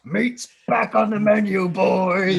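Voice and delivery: high, very loud roar; orc war cry. Acted. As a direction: shout it to the whole pack as an orc leader. Quotes the lead orc's famous cry.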